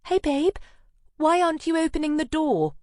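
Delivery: high, loud cheery friend voice; chirpy girlfriend calling up from the street; bright friend Anna. Acted.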